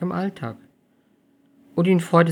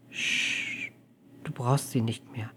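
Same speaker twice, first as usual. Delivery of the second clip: hushing; 8 dB quieter, slower than usual. Acted. Hushing Amelie tenderly.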